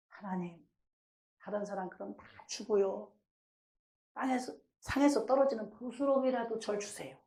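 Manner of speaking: soft, lowly acted prayer; humbled plea. Believer humbly asking God for crumbs, softly pleading. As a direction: beg quietly for mere scraps. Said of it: "그만큼 이제 낮아진 거예요".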